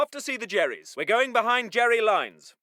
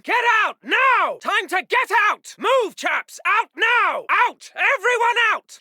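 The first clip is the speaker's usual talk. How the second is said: urgent shouted order; 3 semitones higher than usual. Acted. Officer evacuating his chaps, urgent shout. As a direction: shout everyone out now.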